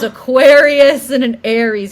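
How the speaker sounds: loud, high and slow, like a verdict; driver reading signs. Retold or imitated. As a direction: announce the signs like an astrologer driver. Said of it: "He goes".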